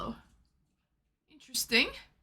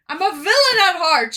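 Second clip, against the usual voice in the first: louder, mock-villainous boast; a touch of a proud villain, louder; playful villain; 6 semitones higher, 9 dB louder than usual. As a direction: announce you're the bad guy, hammed up.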